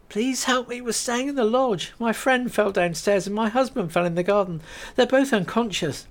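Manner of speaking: high, pleading explanation; urgent plea to be believed; Kate voiced, desperate. Kate tells the milkwomen about her husband, desperately.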